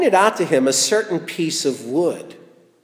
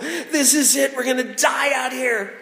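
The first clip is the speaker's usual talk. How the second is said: despairing cry; 7 semitones higher than usual. Acted. Preacher voicing Israelites finding bitter water, despairing. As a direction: cry out that you're doomed.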